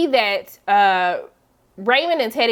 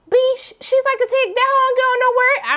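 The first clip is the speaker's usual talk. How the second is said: much higher, exaggerated shade; sassy put-down; 12 semitones higher than usual. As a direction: throw shade with a mock high voice. Voicing a character's snarky remark.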